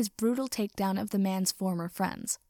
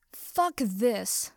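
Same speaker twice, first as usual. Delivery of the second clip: narrated angry cry. A narrator's reading. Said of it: "cried out angrily".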